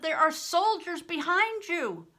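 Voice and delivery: loud, high warning cry; desperate warning shout. A narrator's reading. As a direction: yell the danger over the noise. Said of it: "I shouted".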